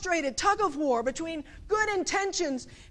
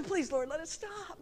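softer, restrained plea; pleading prayer; 8 dB quieter than usual. Acted. Parent praying over a crying baby, softly pleading. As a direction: beg quietly, as in a small prayer. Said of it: "and then there's the".

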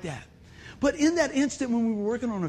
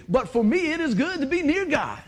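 preacher reading the psalm, lifted higher and fervent; lifted proclaiming quote; 6 semitones higher, 3 dB louder than usual. Shown as a demonstration. Preacher quoting the psalm to the congregation, lifted and fervent. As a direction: make the verse ring out. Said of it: "Notice I said".

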